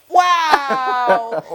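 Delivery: loud amazed exclamation, half shouted; amazed wow. Retold or imitated. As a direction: blurt it out, blown away by the second show. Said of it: "you're like".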